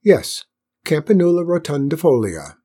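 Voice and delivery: grand, satisfied repeat of the Latin name; self-pleased ceremonial announcement; with a flourish. A narrator's reading. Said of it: "repeated it with a flourish".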